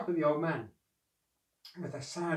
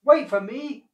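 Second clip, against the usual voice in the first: high, loud plaintive call; acted dog's desperate cry; wailing; 9 semitones higher, 12 dB louder than usual. A narrator's reading. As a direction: wail after someone leaving you behind. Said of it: "wailed the dog".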